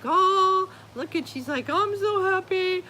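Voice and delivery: high, squeaky happy dog voice; voicing the dog. Voicing her happy dog to the viewers, in a high, squeaky voice. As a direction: chirp it out as the dog.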